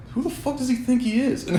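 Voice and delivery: shaking his head at the guy; incredulous. Real, not acted. Repeating his outraged question.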